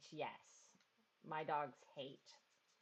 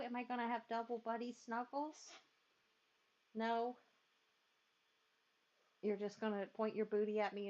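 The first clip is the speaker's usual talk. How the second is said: pet talk; 4 semitones higher than usual. Real, not acted. Owner cooing at her dog wanting a lap cuddle, higher pet talk.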